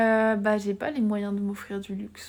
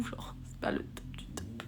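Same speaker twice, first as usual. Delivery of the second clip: quick hushed whisper; ASMR-style whisper; whispering to chat; 11 dB quieter than usual. Real, not acted. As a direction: keep it secret-soft and quick. Whispers a run of chat replies.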